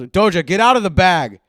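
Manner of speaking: loud, high shout at a pet; yelling at the cat. Real, not acted. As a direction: yell sharply at the cat.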